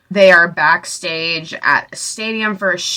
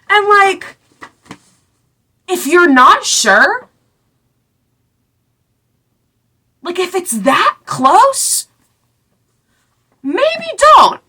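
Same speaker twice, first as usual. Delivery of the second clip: high, slow, pleading voice; pitched-up slow exasperated warning; exasperated high plea; 11 semitones higher, 4 dB louder, slower than usual. Real, not acted. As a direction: beg them to just not, drawn out high. Exasperated advice to character.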